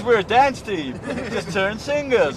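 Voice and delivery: a hammed-up laid-back shrug; mock jive voice, slow and loud; imitating the dancer. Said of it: "He says".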